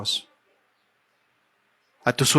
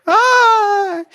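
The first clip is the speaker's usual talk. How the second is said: high, loud wailing ay; a sudden mock sob of grief; crying wail; 5 dB louder than usual. Aunt bursting into tears at the funeral, high wail. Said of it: "ella salió llorando".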